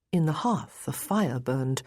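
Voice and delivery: plain reading voice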